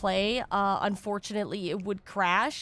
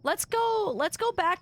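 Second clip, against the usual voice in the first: excited; 9 semitones higher, slower than usual. Real, not acted. Sudden high exclamation.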